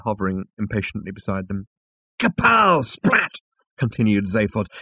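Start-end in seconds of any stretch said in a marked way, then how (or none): 2.2-3.3 high, loud, punchy comic-book bangs; loud sound effects. Acted. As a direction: blast out each bang. Zaphod acting out the explosion.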